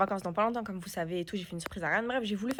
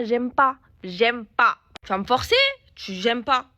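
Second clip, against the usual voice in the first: louder, fed-up retelling of her complaint; mock angry retort; 8 dB louder, slower than usual. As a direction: replay the argument with exasperation. Retold quarrel with lash technician. (colère rejouée, plus fort)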